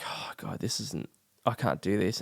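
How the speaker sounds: hushed, near-whispered dismay; retold despair. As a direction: drop to a soft, defeated murmur. Retells despair in courtroom.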